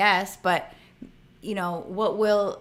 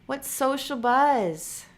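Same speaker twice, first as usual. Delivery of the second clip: puzzled question; 5 semitones higher, much slower than usual. Shown as a demonstration. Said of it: "reading a viewer's suggestion".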